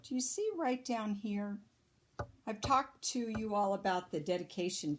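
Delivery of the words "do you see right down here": ordinary delivery, nothing marked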